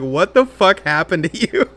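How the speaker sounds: shocked. Retold or imitated. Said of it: "Priya's gonna be like".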